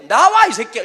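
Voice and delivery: loud furious yell at a student; angry teacher yell. Retold or imitated. Teacher yells at a cheeky student, loud and furious. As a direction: bark it like a teacher about to hit. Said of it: "이러면서 막 때리는 거예요".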